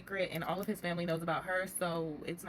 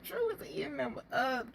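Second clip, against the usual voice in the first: slow, higher, crying-laughing wail; mock wailing cry; 6 semitones higher, slower than usual. Vlogger reacting to the show, mock wailing. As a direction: wail it out like it's too much to bear.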